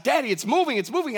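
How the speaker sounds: put-on high, loud, thrilled little boy; excited toddler. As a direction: squeal it like a delighted kid. Father voices excited son.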